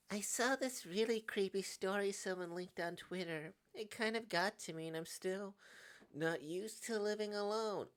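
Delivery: high character voice, uneasy confession; nervous friend confiding. Acted.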